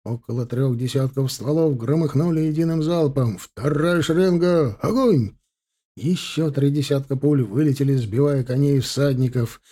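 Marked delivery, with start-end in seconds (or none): 3.4-5.4 high, slow shouted orders; combat callout. Acted. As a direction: yell fire commands down the line. Officer commanding second volley.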